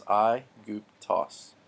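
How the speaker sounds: "aiguptos." very slowly, eye-goop-tos; slow model pronunciation. Shown as a demonstration. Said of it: "that's how that word will come across".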